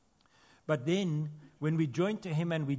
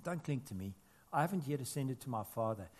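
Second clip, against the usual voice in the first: Jesus; 2 semitones lower, 7 dB quieter than usual. Retold or imitated. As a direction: quietly voice a restrained request, pitched down. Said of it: "And he says".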